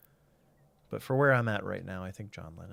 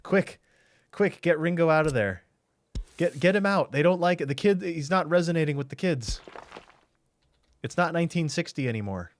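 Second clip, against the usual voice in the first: panicky exec; 7 semitones higher, 6 dB louder than usual. Retold or imitated. Mock TV executives panicking.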